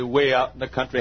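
usual speaking voice

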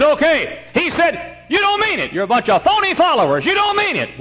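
very high, loud scolding of phony followers; scornful Jesus. Acted. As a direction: rebuke them as fakes, pitch soaring. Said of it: "And he said".

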